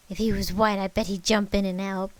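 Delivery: loud, angry grandmother; accusing. A narrator's reading. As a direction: throw it out as a bitter accusation. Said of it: "pointed to me and accused".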